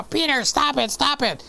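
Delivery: a playful high voice, whining stop it; squirming mock complaint; mock protest. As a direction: play the embarrassed buddy pushing off a hug. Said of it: "and he'd go".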